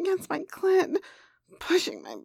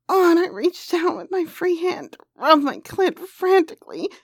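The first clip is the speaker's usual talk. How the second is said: plain reading voice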